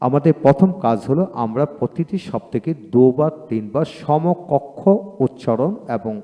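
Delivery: usual speaking voice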